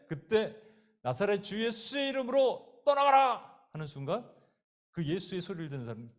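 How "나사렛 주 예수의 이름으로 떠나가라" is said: loud, high, drawn-out exorcism command; ringing slow rebuke; commanding shout. Acted. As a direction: banish the devil at full voice. Luther acted rebuking the devil.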